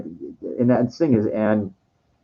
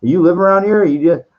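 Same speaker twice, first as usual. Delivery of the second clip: sharp, higher, louder cop voice; cop questioning; 6 semitones higher, 5 dB louder than usual. Retold or imitated. The Beverly Hills cop stops him at night, sharp and loud. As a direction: snap the question like a suspicious cop. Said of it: "And he goes".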